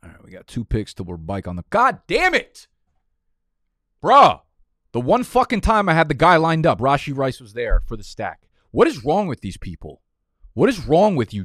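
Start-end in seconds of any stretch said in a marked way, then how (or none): 1.7-2.7 loud, high furious yell; shouted curse. Real, not acted. Same words shouted. 4.0-4.4 loud, high disbelieving bruh; exasperated yell. Real, not acted. Draft pick sniped, rage. 4.9-11.4 loud, high outraged rant; escalating comedy rant. Real, not acted. Escalating rant at other drafters.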